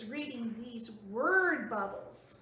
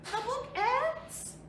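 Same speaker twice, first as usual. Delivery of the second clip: higher, slow, dismayed question; upset elephant, much higher; worried surprise; 9 semitones higher, 4 dB louder, slower than usual. Acted. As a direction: gasp it out in alarm. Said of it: "they look sad".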